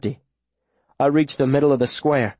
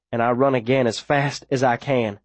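usual speaking voice

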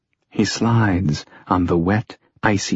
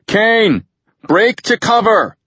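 high, loud urgent command; shouted warning; 13 semitones higher, 5 dB louder than usual. A narrator's reading. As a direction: yell for your partner to get to safety. Handler shouts order mid-gunfight.

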